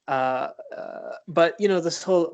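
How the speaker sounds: normal talking voice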